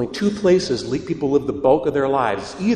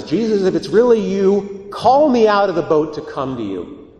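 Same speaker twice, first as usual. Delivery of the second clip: Peter calling out, raised and higher; pleading Peter; 6 semitones higher, 5 dB louder than usual. Retold or imitated. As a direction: call across the water to Jesus. Peter voiced in gospel retelling.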